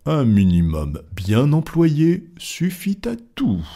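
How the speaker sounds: slow, high, measured maxim; composed rebuttal. Acted. Fogg answers the objection, slow and composed. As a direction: lay it out word by word.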